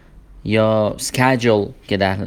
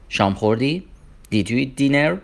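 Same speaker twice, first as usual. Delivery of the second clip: usual speaking voice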